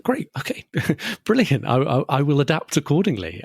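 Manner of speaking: giddy high, laughing mock enthusiasm; playfully over-the-top grateful reaction; mock delight. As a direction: gush with exaggerated cheer through a laugh. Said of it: "so I was like".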